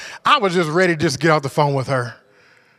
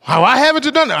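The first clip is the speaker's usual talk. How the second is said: high, louder impatient demand; mock bill collector demand; 7 semitones higher, 4 dB louder, faster than usual. Bill collector nagging him on the phone, impatient. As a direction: bark the collector's nagging question. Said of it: "they just".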